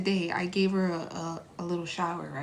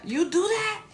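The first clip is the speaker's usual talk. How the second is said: higher, louder mock scold at the cat; 9 semitones higher, 4 dB louder than usual. Owner scolding her cat after the bath, mock angry. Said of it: "I was like".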